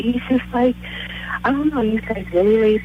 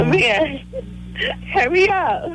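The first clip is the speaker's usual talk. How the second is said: high-pitched, hurrying summons; calling over; 11 semitones higher, slower than usual. Real, not acted. Caller hurries fiancé to the phone, calling out. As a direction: call them over urgently, pitched up.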